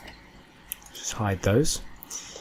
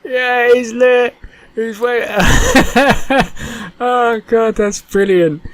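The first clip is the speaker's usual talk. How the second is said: excited squeal; 12 semitones higher, 6 dB louder, slower than usual. Real, not acted. Fish fin waves for first time.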